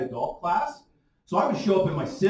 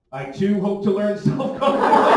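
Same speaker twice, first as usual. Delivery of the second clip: louder, half-laughing mock sincerity; mock earnest reply; 4 semitones higher, 8 dB louder than usual. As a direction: confess it with a straight, awkward face. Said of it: "have to turn back and say".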